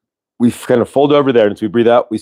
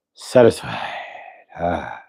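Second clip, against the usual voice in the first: Satisfied breathed out softer on a falling sigh; sighed word; 5 semitones lower, 6 dB quieter, slower than usual. Shown as a demonstration. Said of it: "we do it with a sigh".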